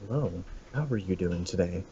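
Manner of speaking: low, soft drive-thru mic greeting; soft customer-service voice. Retold or imitated. Drive-thru worker greeting a customer over the mic, soft and low. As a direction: murmur it close into a headset. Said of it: "put the mic next to my mouth and be like".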